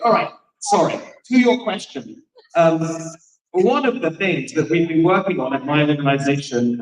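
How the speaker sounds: normal talking voice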